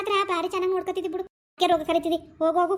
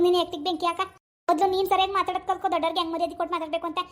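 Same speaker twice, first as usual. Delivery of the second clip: ordinary talk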